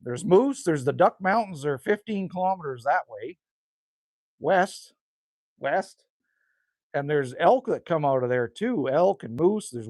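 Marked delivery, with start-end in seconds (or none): none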